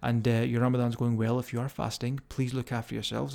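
ordinary talk

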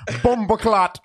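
put-on high, loud Caribbean-accent outburst; mock Caribbean accent. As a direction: holler it in a playful Caribbean accent. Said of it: "a Caribbean accent".

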